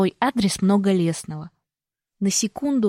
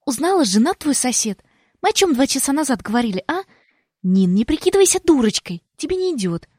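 higher, impatient sister, fast; displeased sister; 6 semitones higher, 3 dB louder than usual. A narrator's reading. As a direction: nag her impatiently. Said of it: "Недовольно фыркает".

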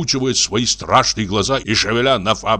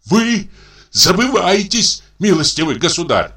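an offended gentleman flaring up at an insult; 9 semitones higher than usual. Acted. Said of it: "вспыхнул он".

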